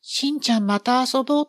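put-on high, loud little-girl call; 女の子の呼びかけ. A narrator's reading. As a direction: call out a goodbye in a high child's voice. 春ちゃんが帰り際に呼ぶ. (高く張った女の子の声)

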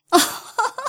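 high tinkling laugh; light laugh. Acted. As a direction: laugh off the cat's joke. Warbler laughs at cat.

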